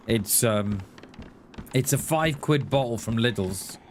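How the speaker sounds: normal talking voice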